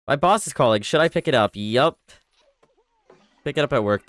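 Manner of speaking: usual speaking voice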